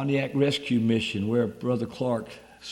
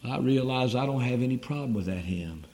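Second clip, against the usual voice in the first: usual speaking voice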